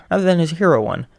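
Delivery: ordinary delivery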